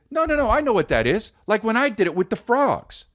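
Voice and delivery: put-on high-pitched little-kid voice; Calvin. Son telling dad he understands happy crying, high child voice. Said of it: "He said".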